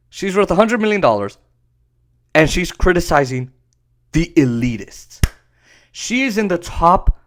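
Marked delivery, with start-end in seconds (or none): none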